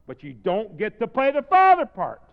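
loud, high-pitched preacher's emphasis; full-force preaching. Real, not acted. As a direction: build to a shout, then stretch the last phrase.

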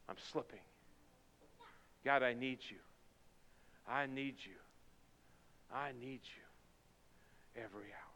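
soft, pleading prayer; hushed prayer. Acted. Pastor leading the church in a whispered plea, hushed prayer. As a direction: pray it quietly and humbly.

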